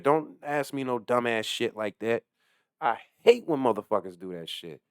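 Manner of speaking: ordinary delivery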